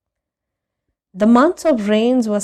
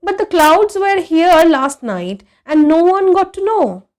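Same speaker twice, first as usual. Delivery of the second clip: higher, louder, insistent; protesting boy; 9 semitones higher, 9 dB louder than usual. A narrator's reading. A boy protesting to the old man, higher and louder. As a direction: object with childish urgency.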